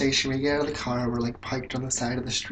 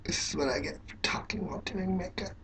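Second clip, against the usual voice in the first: soft whispered aside to herself; hushed self-mutter over a makeup slip; 6 semitones higher, 8 dB quieter than usual. Real, not acted. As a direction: whisper it while concentrating on makeup. Muttering while doing makeup.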